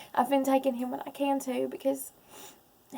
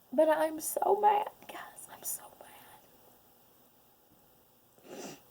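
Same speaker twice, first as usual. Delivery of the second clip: barely able to get it out; real choked-up whisper; tearful whisper; 4 semitones higher than usual. Real, not acted.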